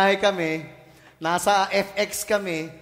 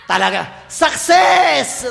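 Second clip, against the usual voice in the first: loud, higher cry of success; triumphant cry; 4 semitones higher, 9 dB louder, much slower than usual. Retold or imitated. Pastor relives his relief at the restroom, loud triumphant cry. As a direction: shout it out like you finally made it. Relief after reaching the toilet.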